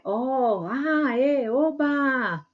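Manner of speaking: delighted drawn-out "Oh, ah, oba!"; joyful exclamation. Shown as a demonstration. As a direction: cheer like good news just came. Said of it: "É, alegria".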